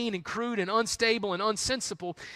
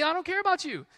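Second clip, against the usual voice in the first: "about" pitched much higher, rising in mock outrage; indignant outburst; 9 semitones higher, faster than usual. Nabal refusing David's men, outraged and high. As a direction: fling "I don't care about you" up high.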